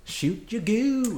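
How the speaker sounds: slow, high, stretched-out goofy voice; silly drawn-out voice. Pretend. Silly catchphrase voice.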